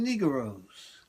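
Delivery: put-on Southern drawl on "Negroes"; southern accent. Shown as a demonstration. Comic imitating Southerners saying "Negroes", Southern accent. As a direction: stretch "Negroes" into three syllables, Southern-style. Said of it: "they have their southern accent".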